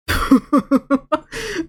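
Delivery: bright high laugh. Real, not acted. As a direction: burst out laughing. Laughing at own stutter.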